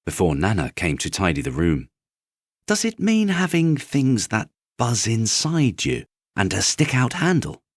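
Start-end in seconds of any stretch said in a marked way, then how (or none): 2.7-7.6 higher, eager little rabbit voice; bright-eyed small rabbit; rabbit's question. A narrator's reading. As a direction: ask like a naive young toy.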